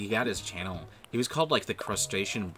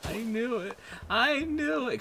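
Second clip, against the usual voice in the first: "I knew it" jumping much higher, then louder; rising to a squeal of being proven right; building vindicated cry; 3 dB louder, slower than usual. Real, not acted. Fearing the players didn't care, rising squeal of vindication.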